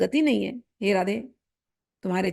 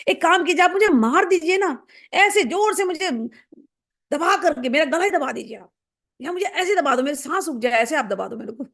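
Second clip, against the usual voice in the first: eager pleading; 7 semitones higher than usual. Acted. Playful plea to be punished.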